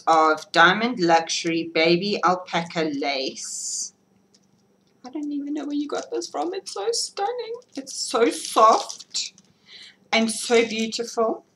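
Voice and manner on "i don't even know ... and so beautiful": delighted gushing. Real, not acted. Gushing over a gifted shawl.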